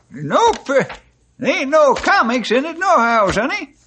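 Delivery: put-on high, crotchety old-timer. Stumpy teasing Bill about the magazine, crotchety. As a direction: play a grumpy old codger.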